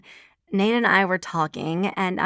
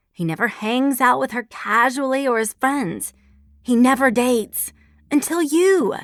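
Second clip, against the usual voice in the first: excited; 6 semitones higher, 5 dB louder than usual. A narrator's reading. Friend excitedly explains revelation.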